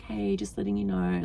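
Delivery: low, soft murmur in a client's ear; imitating a discreet nudge, hushed; retold whisper. Telling the client his hour is up, low and hushed. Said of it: "whisper in his ear".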